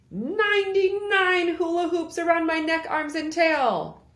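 proud, strained character voice; straining hula hooper. Acted. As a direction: hold it out as the hoops pile up.

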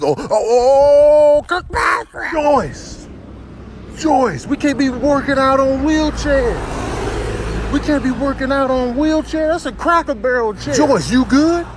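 high, drawn-out cry of alarm; gym buddy voice. Acted. Workout partner seeing Joyce fall, high and slow alarm. Voicing a gym lady falling.

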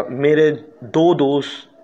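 usual speaking voice

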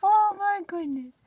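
high, loud cooing pet voice; baby talk to pets. Acted. Owner greeting her rabbits in a high cooing voice. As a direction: coo up high at the bunnies.

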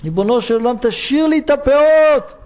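put-on a sick boy crying to God, begging; crying plea. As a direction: beg through tears like a little boy. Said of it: "והתחיל לבכות".

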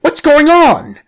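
high, loud bewildered cry; startled. Acted. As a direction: shout in panic and confusion. Boys shocked by talking snake.

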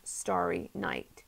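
plain reading voice

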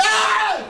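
sudden high loud yelp of alarm; retold yelp of fright from a gang; startled group shout. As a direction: jump back with a loud shocked "Ah!". Group reacts to Druid claim.

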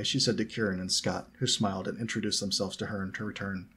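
plain reading voice

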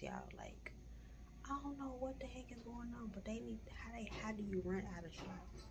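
hushed whisper in a waiting room. Real, not acted. Talking quietly in the clinic lobby.

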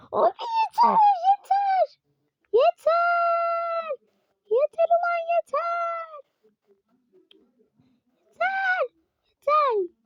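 high, strained wails of yeter; near-crying shrieks of fed-up rage; breaking falsetto cry of frustration; frustrated wailing. Real, not acted. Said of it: "o kadar sinirliyim ki şu an".

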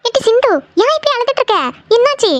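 high, worried questioning; alarmed concern. Acted. Relative asks crying woman what happened, alarmed.

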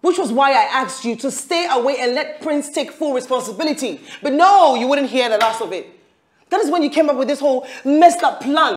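angry blame. Acted. Woman blaming her lover for the plan, angry blame.

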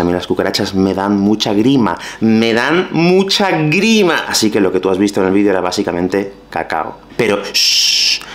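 ordinary delivery

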